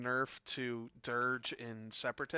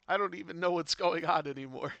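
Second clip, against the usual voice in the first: laughing; 6 semitones higher, 5 dB louder, faster than usual. Real, not acted. Laughing at own outburst.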